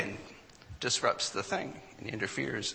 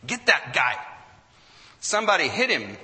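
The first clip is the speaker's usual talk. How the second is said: loud, rough crowd shout for revenge; crowd yelling; 4 semitones higher, 7 dB louder than usual. Retold or imitated. Angry fans yelling at Bartman in the stands, loud hostile shout. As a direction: rile up the mob against him. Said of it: "they start yelling".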